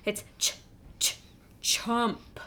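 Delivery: "chump" begun with ch, repeated; sounding out chump. Shown as a demonstration. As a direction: stutter out "ch-" then "chump". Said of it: "Not jump".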